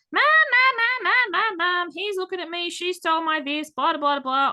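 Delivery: put-on high, loud whiny kid calling Mom; whiny tattling little sibling.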